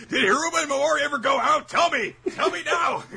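very high, loud fanboy pleading; hammed-up desperate interrogation; mock frantic demand. Rabid fan demanding answers from the manga author, mock frantic shriek.

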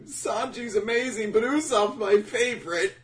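high-pitched, hammed-up fanboy squeal; gushing fanboy. Fanboy gushing to Oda about favorite characters, high mock squeal. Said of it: "separate being a fanboy".

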